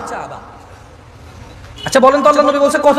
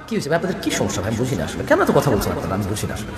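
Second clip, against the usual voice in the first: quiet chiding; 14 semitones lower, 8 dB quieter than usual. Real, not acted. Preacher chides noisy audience.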